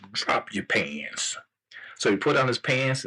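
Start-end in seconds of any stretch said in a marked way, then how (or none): 0.1-1.4 slow, lowered royal command; drawn-out low kingly order. Acted. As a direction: order it slowly as a king, pitched down. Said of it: "King said".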